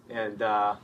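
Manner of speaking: ordinary talk, nothing marked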